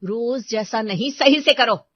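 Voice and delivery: slow then loud sharp command; stern order. Acted. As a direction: demand she do it right. Orders maid.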